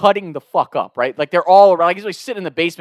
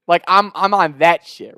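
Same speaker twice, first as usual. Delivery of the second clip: ordinary talk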